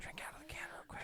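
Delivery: fast hushed whisper. Real, not acted. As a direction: rush it out in a whisper. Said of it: "why are we whispering".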